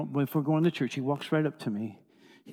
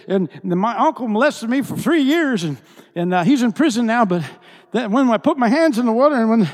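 high, loud retold testimony; impassioned testimony; 7 semitones higher, 10 dB louder than usual. Preacher voices a man testifying about abuse, loud and impassioned. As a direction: pour it out at full force.

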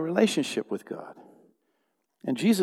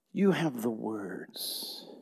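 soft and slow; 4 semitones lower, 9 dB quieter, much slower than usual. Acted. Soft closing repetition.

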